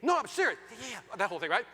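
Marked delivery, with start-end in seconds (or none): 0.0-0.6 high-pitched, urgent protest; emphatic insistence. Retold or imitated. Husband insisting to his wife, exasperated emphasis. 0.8-1.2 very soft, quick brush-off; dismissive. Retold or imitated. Wife still brushing off her husband, imitated absent agreement.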